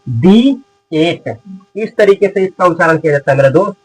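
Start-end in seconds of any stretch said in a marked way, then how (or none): 0.1-1.4 दी drawn out long and slow before एट; slow demonstration of दी एट with long ee. Shown as a demonstration. Teacher showing how it is read before एट, slow long-ee दी. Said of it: "तो इसको पढ़ते दी".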